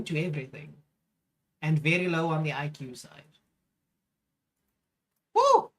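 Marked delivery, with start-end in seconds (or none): 5.3-5.7 a sudden high, loud frustrated "woo" yell; frustrated whoop. Real, not acted. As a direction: let the frustration burst out as a shout. Frustrated outburst at the video.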